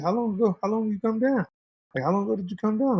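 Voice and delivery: a hint of a confused friend; buddy's voice. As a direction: pitch up and press the questions. Buddy questioning in story.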